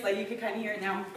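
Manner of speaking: ordinary delivery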